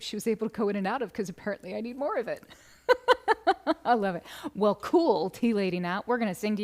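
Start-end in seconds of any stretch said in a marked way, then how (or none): 2.9-3.8 a high, loud burst of laughter. Real, not acted. Laughing at own sleep jealousy.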